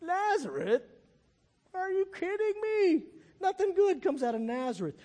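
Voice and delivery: high-pitched, scornful disbelief; hammed-up dismissive astonishment; mock incredulous. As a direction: act out a scoffing skeptic who can't believe it. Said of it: "I think it was kinda like".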